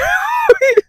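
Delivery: a sudden high cry of a laugh. Real, not acted. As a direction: burst out in a shrieking laugh. Reacting to wine-not-alcohol claim.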